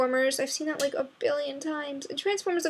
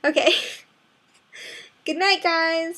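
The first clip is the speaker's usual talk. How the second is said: laughing; 4 semitones higher than usual. Real, not acted. Laughing sign-off.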